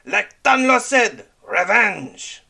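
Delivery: high, loud cry for revenge; fierce pirate rallying cry; angry shout. Acted.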